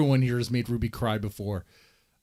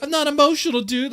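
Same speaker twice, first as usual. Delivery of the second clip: mock whiny squeak, much higher and louder; mocking high-pitched repeat; 13 semitones higher, 6 dB louder than usual. Host mocking a guest's denial in high squeaky voice. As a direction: parrot it back in a high squeaky voice. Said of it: "Let's hear that again. I like that".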